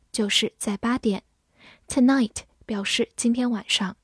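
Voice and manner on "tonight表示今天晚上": normal talking voice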